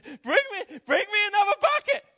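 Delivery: high, loud cry breaking with joy; ecstatic cry. Acted. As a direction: scream for another bucket, overwhelmed. Mother's climax, voice cracking.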